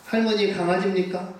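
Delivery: usual speaking voice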